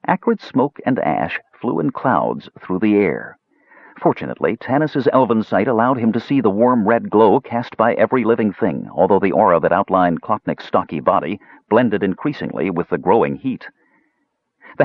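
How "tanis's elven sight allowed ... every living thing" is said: ordinary delivery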